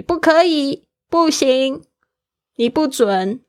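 sharp high-pitched no from a parent; adults scolding. Retold or imitated. Parent forbidding a child, mock stern no. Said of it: "很多大人会告诉你".

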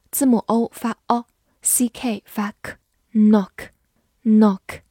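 slow, careful knock with a clear final K; sound by sound. Shown as a demonstration. Teacher breaks knock into sounds for students, slow and clear. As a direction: knock said with O as in O and C K as K, slowly.

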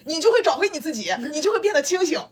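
high, fast laughing delivery. Real, not acted. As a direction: laugh out the punchline. Joking punchline, laughing.

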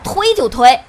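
loud, fed-up yell; angry shout. Acted. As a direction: bark back in anger. Escalating dare to push. (大声吼)

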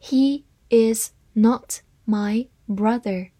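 slow, one word at a time; deliberate model reading for learners; slow read-aloud. Shown as a demonstration. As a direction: read it slowly, pausing between words. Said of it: "好，慢慢来读".

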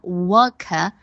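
British non-rhotic "wuh-kuh" for "work"; slow British "worker" without r colour; British accent. Shown as a demonstration. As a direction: say "work" the British way, both r sounds dropped. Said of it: "英国人会把worker这个单词读作".

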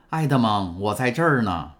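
a mild joyful call out; glad call. A narrator's reading. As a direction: call to him at the door. Calling to him at the door.

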